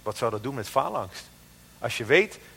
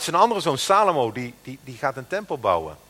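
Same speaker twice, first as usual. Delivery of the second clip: ordinary talk, nothing marked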